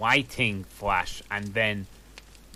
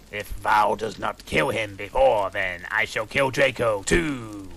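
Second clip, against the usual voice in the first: louder menacing threat; lightly voiced dark lord threatening; evil villain; 4 dB louder than usual. A narrator's reading. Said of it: "said evilly in his raspy voice".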